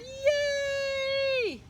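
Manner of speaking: high excited crowd cheer. A narrator's reading. Crowd cheers as Big Bark is carried off, high cheer. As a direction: whoop with joy. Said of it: "the crowd cheered".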